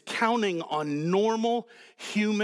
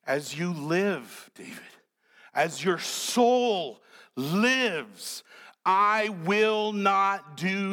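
solemn oath; 3 semitones higher, much slower than usual. Acted. Uriah's solemn oath, very slow.

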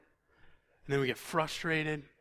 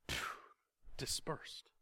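whispered; 3 semitones lower, 15 dB quieter than usual. Real, not acted. Disciples fled at the cross.

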